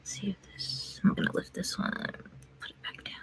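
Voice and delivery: soft ASMR-style whisper. Acted. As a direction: whisper close to the mic. Whispering while doing nails.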